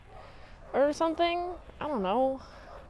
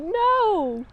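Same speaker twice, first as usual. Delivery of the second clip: much higher, pleading no no; exasperated protest; 9 semitones higher, 4 dB louder than usual. Acted. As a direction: jump in to defend the Enterprise. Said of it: "And I'm like".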